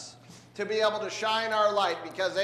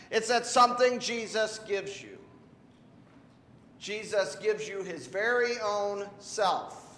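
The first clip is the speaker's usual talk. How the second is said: normal talking voice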